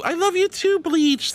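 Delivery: high, bright-voiced I love you too; high happy thanks; excited reply. Real, not acted. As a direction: gush it back happily. Returning viewer's love.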